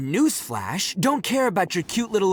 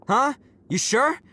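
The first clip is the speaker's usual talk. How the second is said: normal talking voice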